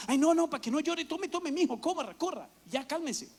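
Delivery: put-on high, loud pleading with a wailing child; flustered parent. (padre agobiado suplicando, más agudo y fuerte)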